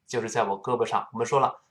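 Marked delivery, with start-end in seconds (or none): none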